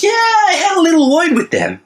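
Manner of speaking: very high, loud, smug taunt; hammed-up smug heel taunt; gloating wrestler voice. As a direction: gloat loudly into the mic. Rat Trap gloats at Authority.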